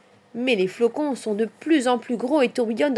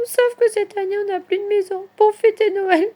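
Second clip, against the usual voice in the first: very high, louder little whine; tearful baby voice; 12 semitones higher, 6 dB louder than usual. A narrator's reading. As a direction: lament like a baby animal near tears. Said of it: "pleure bébé Martre". (voix aiguë de bébé qui pleurniche)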